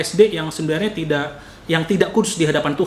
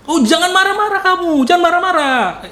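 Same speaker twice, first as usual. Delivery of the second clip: high and loud, hammed-up Batak bluntness; 12 semitones higher, 5 dB louder than usual. Preacher imitating how Batak speech sounds to Javanese, loud and high. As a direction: imitate a loud voice that sounds angry but isn't. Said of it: "suara kita kenceng".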